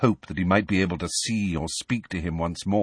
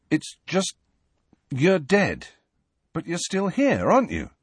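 higher, hesitant questioning voice; awkward pressing boy; 8 semitones higher than usual. Acted. Harry awkwardly pressing the ghost.

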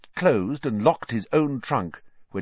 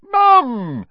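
loud, high cry of feigned surprise; as though only just spotting her; mock-delighted greeting; 11 dB louder than usual. A narrator's reading. Fred pretending he'd just spotted his mother, mock delight.

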